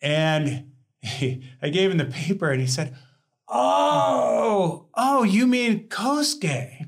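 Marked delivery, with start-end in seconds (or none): 3.5-6.9 high, slow, drawn-out oh of recognition; sudden realization. Retold or imitated. The professor suddenly realizing who is meant, a high drawn-out oh. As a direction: let the penny drop loudly.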